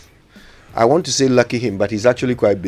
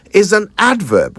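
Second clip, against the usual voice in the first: ordinary delivery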